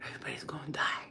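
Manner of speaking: hushed menacing whisper. Pretend. Joking to viewers about killing everyone, whispered. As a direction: breathe it out low and ominous.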